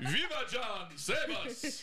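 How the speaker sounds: high pleading cry; mock plea. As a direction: beg the weatherman for rescue. Mock pleas to weatherman.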